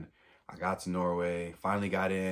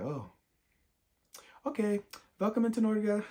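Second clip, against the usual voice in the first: a lightly voiced border officer, pitched much higher; mock border officer. Passport officer waving him through, in a light mock-cheerful voice. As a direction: play the officer's sudden cheerful okay.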